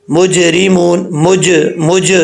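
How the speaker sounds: مجرمون with the ج bouncing, slow; qalqala bounce. Shown as a demonstration. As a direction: bounce the ساکن ج in مج. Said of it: "قلقلہ جب ہوگا تب کون سی آواز آئے گی". (ج میں قلقلہ کے ساتھ آہستہ)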